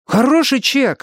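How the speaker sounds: very high, hurried pleading; eager jabbering. A narrator's reading. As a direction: gabble it eagerly in a high boyish voice. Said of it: "затараторил Арни". (тараторит высоким, умоляющим голосом)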